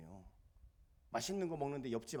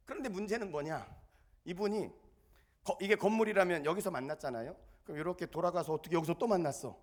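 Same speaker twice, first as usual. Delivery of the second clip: ordinary talk, nothing marked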